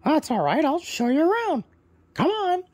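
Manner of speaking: high, loud squawky parrot; proud parrot introducing himself, high and loud. Acted. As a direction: chirp it out as a cheerful parrot bird. Parrot leads them.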